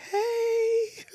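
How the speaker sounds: tiny high laughing hey; laughing squeak. Retold or imitated. Guest at the door, squeaky laughing hello.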